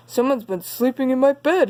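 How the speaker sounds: lightly grumbled big bear line; a hint of a grumpy big bear; grumbling papa bear. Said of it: "he grumbled".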